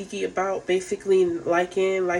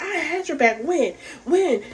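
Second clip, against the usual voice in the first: much higher, indignant protest; mock defensive Sapphire; 10 semitones higher than usual. Sapphire defending herself to Suki, high indignant mock protest. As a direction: pitch it way up, offended and insistent.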